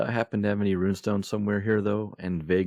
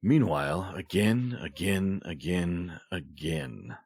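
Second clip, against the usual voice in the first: fading echo; much slower than usual. Acted. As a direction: trail away like a repeating echo. Spoken scene-change effect fading out.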